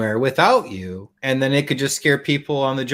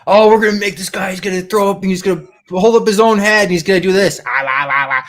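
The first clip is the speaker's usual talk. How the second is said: put-on excited kid; 5 semitones higher than usual. Little boy to his dad, higher and excited. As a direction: play the thrilled little boy explaining his plan. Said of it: "I'm like".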